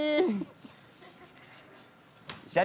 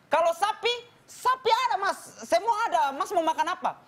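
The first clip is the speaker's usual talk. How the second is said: rising ever higher, laughing eager waiter; 8 semitones higher than usual. Retold or imitated. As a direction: keep offering, getting more eager. Waiter voiced in joke.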